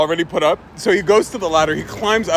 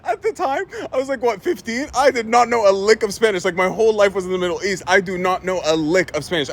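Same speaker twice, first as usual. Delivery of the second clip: ordinary talk, nothing marked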